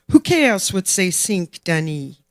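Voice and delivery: put-on mother's French-accented dismissal, projected. A narrator's reading. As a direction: toss it off with a hint of French accent. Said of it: "In a thick French accent".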